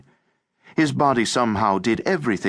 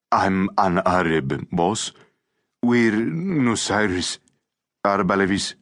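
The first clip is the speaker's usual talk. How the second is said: timid. A narrator's reading. Recoiling boy tells the boss who he is, timid.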